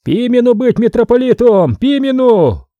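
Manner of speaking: high yell. A narrator's reading. Vaska bellows for Pimen amid the brawl, high yell. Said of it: "заорал".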